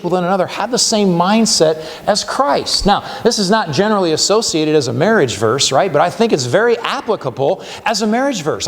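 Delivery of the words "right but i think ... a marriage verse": ordinary delivery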